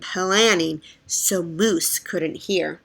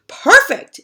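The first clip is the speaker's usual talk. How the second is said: high, loud praise; delighted boss; pleased; 11 semitones higher, 8 dB louder than usual. Acted. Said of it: "said Moose".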